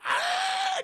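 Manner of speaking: very high, loud mock shriek; imitated terrified scream. Blade faces the robot, screaming. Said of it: "She's just screaming".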